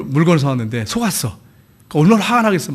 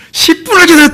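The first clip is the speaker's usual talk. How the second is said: much higher, louder indignant outburst; angry complaint; 12 semitones higher, 6 dB louder than usual. Retold or imitated. Churchgoer yelling about waiting for the car, angry. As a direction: snap it out like a fed-up driver. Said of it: "막 화를 내면서".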